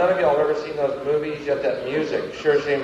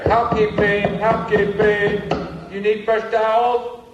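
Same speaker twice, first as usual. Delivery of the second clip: higher, slow singsong maid call; hotel maid; 5 semitones higher than usual. Retold or imitated. Maid knocking at the hotel door, singsong call. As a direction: call through the door like a chambermaid. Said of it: "every morning they're there".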